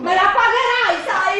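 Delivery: high, shouted threat; threatening. Acted. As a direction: promise revenge. Trapped wife threatening.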